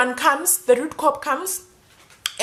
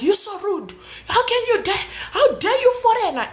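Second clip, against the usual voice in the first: high, sneering mimicry of a rude cop; mocking cop voice, high and scornful; 10 semitones higher than usual. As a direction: ham up a jeering officer, pitched way up. Cops mocking her in car.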